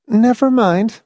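quick, high-pitched dismissal; hasty. A narrator's reading. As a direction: brush the question aside. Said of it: "Marcia said quickly".

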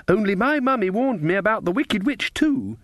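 put-on high, innocent child voice; wide-eyed little girl. A narrator's reading.

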